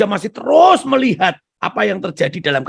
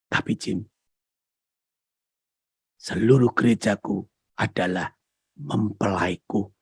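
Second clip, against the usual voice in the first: tender voice of Jesus; 7 semitones lower, 4 dB quieter than usual. Retold or imitated.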